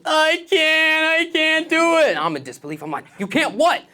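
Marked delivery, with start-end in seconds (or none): 0.0-2.2 put-on high, loud whiny kid sob; crying kid voice. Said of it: "He bursts into tears". 3.2-3.8 disbelief. Retold or imitated. Disbelief at crying kid.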